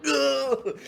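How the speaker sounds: high strained grunt as he's flung; a jolted cry of effort; startled grunt. Acted. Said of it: "so he's like".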